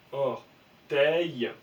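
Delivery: orteil étiré, très lentement; lire lentement. Shown as a demonstration. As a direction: poser orteil syllabe par syllabe. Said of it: "on sait qu'il va se faire est". (orteil dit très lentement)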